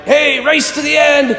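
high, eager call to friends; calling out, pitched well up; excited. Retold or imitated. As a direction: re-enact a sudden excited challenge. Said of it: "I got kind of excited".

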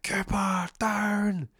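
high-pitched urgent yell; shouted warning. Acted. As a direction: shout a warning with drawn-out words. Acted warning before drive-by shooting.

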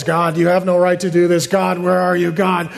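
whiny, monotone griping at God; mock grumbler's whine; whining complaint. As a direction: complain in a droning, put-on whine. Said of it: "Complaining and grumbling is".

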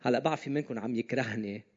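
ordinary talk

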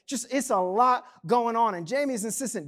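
usual speaking voice

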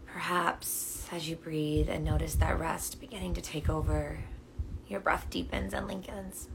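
calm meditation guide with long pauses; unhurried, soothing invitation to notice and breathe; guided meditation. Real, not acted. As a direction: guide them gently into their breath.

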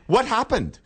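high-pitched bad English accent; amateur Cockney impression; mock Cockney. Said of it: "she was real cockney".

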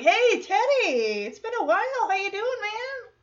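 playful high-pitched hello; acted friendly greeting. Acting out classmate greeting old friend.

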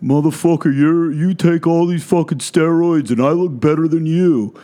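a hint of a sneering troll; mocking commenter. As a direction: read the hater comment in a lightly put-on voice. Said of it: "you get the comments".

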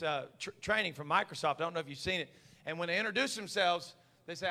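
normal talking voice

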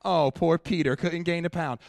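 high, loud, teasing pity voice; mock pity. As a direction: whine it like a pitying crowd laughing at him. Mocking his own sad story.

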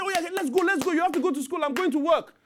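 high, loud, upbeat let's-go; eager weekday hustle. Retold or imitated. Parent rushing children to school and work, high and eager. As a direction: hurry the kids out cheerfully.

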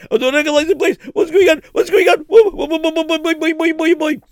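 high, loud panicked cries; screaming while lifted in the air; terrified. Acted. Lost in strange place.

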